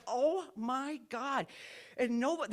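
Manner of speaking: ordinary talk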